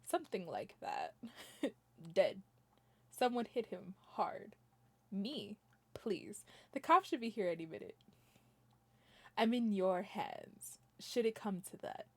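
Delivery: a quiet, low, steady defendant voice; low murmuring game character; low calm client. Acted. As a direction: play the cool suspect, hushed and low.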